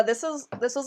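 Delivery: ordinary delivery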